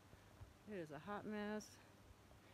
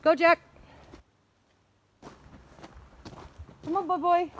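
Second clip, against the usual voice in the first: loud, higher call to the dog; 9 semitones higher, 12 dB louder than usual. Real, not acted. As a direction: call Jack back to the path. Calling the dogs.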